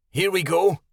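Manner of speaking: muffled, mouth full of fur. Acted. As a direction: speak through a mouthful of hide. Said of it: "said in a muffled voice".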